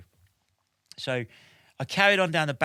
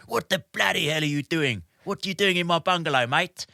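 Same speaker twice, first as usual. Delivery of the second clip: higher, booming imitation of an Afrikaans man; booming Afrikaans accent; 5 semitones higher than usual. Bungalow owner confronts an intruder, booming imitated Afrikaans accent. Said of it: "this booming, like, voice in the Afrikaans".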